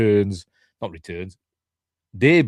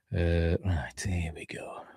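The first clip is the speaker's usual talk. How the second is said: whispering; 5 semitones lower, 8 dB quieter, slower than usual. Real, not acted. Muttering while checking figures.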